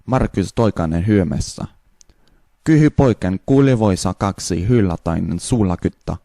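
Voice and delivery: Finnish-sounding gibberish; fake Finnish. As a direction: babble like Finnish. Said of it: "the Finnish".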